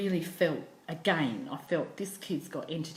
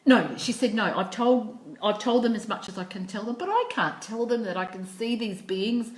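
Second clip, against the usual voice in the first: louder, higher insistent telling; louder insisting voice, retelling the girl; 4 semitones higher, 7 dB louder than usual. Retold or imitated. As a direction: press the point hard.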